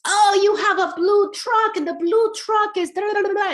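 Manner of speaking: put-on high, sing-song baby talk; playful narrating to toddler. Shown as a demonstration. Mom narrating the blue truck to her toddler, high and sing-song. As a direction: chirp it up high for a little one. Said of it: "So you're cooking".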